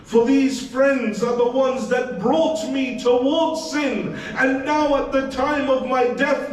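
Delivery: high, fervent sermon lament; impassioned preaching. Acted. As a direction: preach the dead man's grief at full force. Dead man's lament preached.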